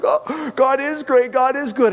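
high-pitched cheer of praise; mock celebrating. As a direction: imitate a relieved crowd cheering. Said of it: "got to celebrate a little bit".

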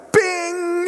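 high startled bing; sudden jolt. Retold or imitated. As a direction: snap awake with a high ping. Jolted awake.

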